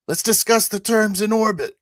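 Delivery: high, loud in-character call; yelling. Acted. Pilot bargaining with mech from ship, yelling. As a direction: yell it across to the enemy. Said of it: "I yell at".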